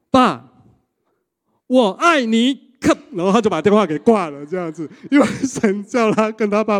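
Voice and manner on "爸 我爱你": high, loud, slow I-love-you; slow earnest declaration. Retold or imitated. Son finally telling his father I love you, slow and earnest. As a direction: say it slowly with courage. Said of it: "然后跟爸说".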